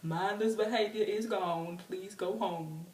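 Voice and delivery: slow official drone; imitated officer, slow and stretched; policeman announcement. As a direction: send the crowd home in long drawn-out words. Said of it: "That's exactly how".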